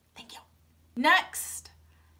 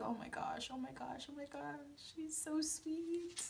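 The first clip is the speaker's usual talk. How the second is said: fading to a much softer hush, slowing down; swooning fade-out; 16 dB quieter than usual. Retold or imitated. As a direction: melt away into a whisper. Melting into a puddle.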